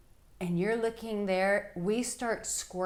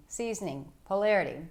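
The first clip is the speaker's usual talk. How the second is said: usual speaking voice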